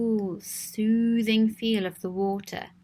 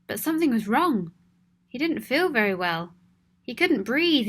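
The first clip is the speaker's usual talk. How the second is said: ordinary delivery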